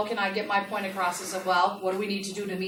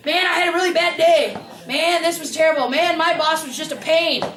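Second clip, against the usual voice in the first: loud, higher whiny rant about the boss; complaining employee; 6 semitones higher, 7 dB louder than usual. Retold or imitated. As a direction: vent like a fed-up worker online. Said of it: "'Cause there's sometimes people like to do that".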